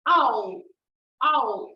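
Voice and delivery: loud cry of ow; mock pain cry. Shown as a demonstration. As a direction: yelp ow like you hurt your foot. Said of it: "Your feet".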